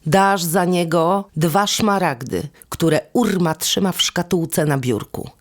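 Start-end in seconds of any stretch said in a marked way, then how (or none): none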